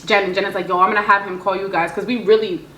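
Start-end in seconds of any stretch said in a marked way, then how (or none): none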